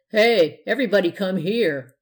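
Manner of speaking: loud, excited call to the room. A narrator's reading. Man summons party guests to see the costume, excited. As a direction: call everyone over excitedly. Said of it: "said the man excitedly".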